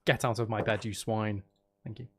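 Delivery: ordinary talk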